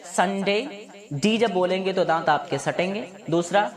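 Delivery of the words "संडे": संडे with the d said against the teeth; careful English संडे, teeth touching on the d; correct pronunciation. Shown as a demonstration. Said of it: "इसके लिए correct क्या होता है".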